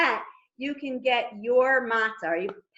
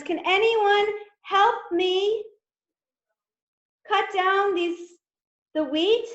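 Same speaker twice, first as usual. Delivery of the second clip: higher, slow and pleading; little hen voice; 9 semitones higher, 3 dB louder than usual. Acted. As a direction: call out to her friends like a hen. Hen asks friends to reap.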